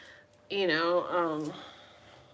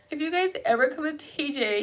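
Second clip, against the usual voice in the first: ordinary talk, nothing marked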